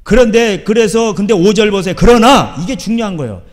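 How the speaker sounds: forceful, loud sermon emphasis; loud preaching. Real, not acted. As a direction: hammer the point home to the congregation. (힘주어 크게 외치는 설교)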